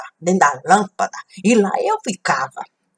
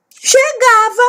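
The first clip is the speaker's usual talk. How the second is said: dramatic exclamation; 6 dB louder, slower than usual. Acted. Dramatic arrival of the boy.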